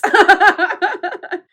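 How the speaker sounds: real laugh, high and loud; burst of laughter. Real, not acted. Laughing at her own joke.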